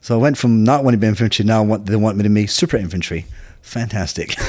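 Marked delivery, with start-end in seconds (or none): 3.6-4.3 sarcastic laugh. Real, not acted. Wanted as super infantry.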